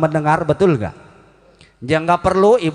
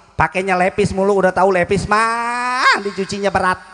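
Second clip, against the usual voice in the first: high-pitched, hammed-up nagging rant; mock exasperated housewife's complaint; grumbling housewife; 5 semitones higher than usual. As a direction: whine and scold over the chores. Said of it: "Biasanya kan ibu-ibu mengomel".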